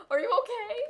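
normal talking voice

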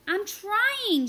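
very high, loud scream. A narrator's reading. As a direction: strain and scream. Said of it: "she screamed".

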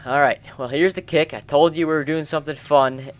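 ordinary talk, nothing marked

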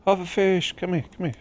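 high-pitched call to a fish; coaxing call. Real, not acted. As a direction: lure it over in a high voice. Calling a fish to catch it.

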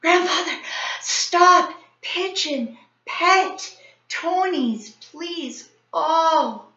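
high, breathless broken gasps of words; out of breath. A narrator's reading. Boy out of breath from the stairs begging grandfather, breathless. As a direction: gasp out the words, winded. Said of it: "we were all out of breath".

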